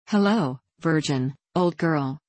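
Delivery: ordinary delivery, nothing marked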